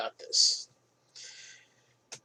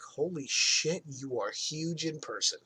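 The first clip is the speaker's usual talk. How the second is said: hushed, slow mock awe; retold awe; 5 dB quieter, slower than usual. Employee looking up at a huge wrestler, understated awe. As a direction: marvel slowly under your breath, lightly acted. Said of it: "looking at him in such awe".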